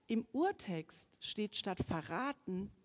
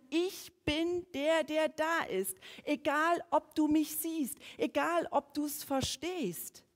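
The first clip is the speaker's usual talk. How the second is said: raised preaching voice; 6 semitones higher, 4 dB louder than usual. Acted. God speaking to doubter.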